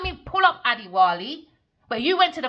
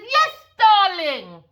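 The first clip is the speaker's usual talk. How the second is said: high, loud sneering sweet-talk; mocking imitation of a fawning woman; mocking Adiwali; 10 semitones higher, 5 dB louder than usual. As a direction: mimic her gushing, much higher and louder. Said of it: "And Adiwali said".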